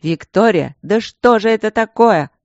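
loud, high exasperated yell; exasperated shout. A narrator's reading. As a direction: shout in disbelief.

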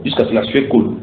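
ordinary talk, nothing marked

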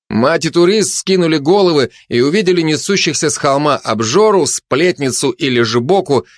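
ordinary delivery, nothing marked

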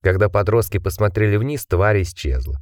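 ordinary delivery, nothing marked

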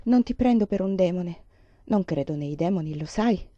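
ordinary delivery

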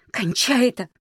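high, sharp demand to stop; angry demand. A narrator's reading. Маришка pulling away from Володя, angry demand. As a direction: burst out, pitch jumping up.

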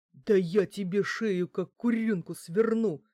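slow, loud menacing promise; ominous threat. A narrator's reading. Giantess threatening to wring the woman's neck, ominous. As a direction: drive each word home with menace. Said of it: "зловеще пообещала Франкенштейн".

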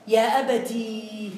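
يا أبتي drawn out slowly, final ي clearly long. Shown as a demonstration. Teacher dictating يا أبتي, long final ي. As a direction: stretch the ending ي of أبتي. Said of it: "بالياء".

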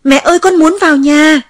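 high, loud desperate scream. Acted. Child screams for mother outside the locked house, loud cry. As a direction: scream for mother with all your strength. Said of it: "Tôi gào lên".

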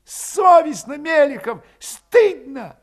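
high, loud scolding; indignant reproach. A narrator's reading. Scolding Melekhov for Cossack bullying, indignant.

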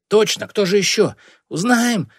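high, loud chiming in; eager exclamation. A narrator's reading. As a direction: pipe up excitedly to join the outcry. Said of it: "рискнул воскликнуть Бакае". (звонкое восклицание)

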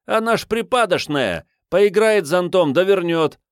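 put-on high pleading urchin boy voice; street boy. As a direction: vouch for her like a street kid. Street boy defends girl.

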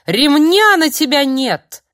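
медленный визгливый ор, намного выше и громче; орать вслед уходящему во всё горло. Acted. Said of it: "заорал дядя Юра вслед". (старик орёт вслед Филиппу)

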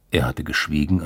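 ordinary delivery, nothing marked

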